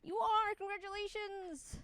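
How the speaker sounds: high, drawn-out sarcastic congratulations; mock congratulations. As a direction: congratulate them with fake sweetness.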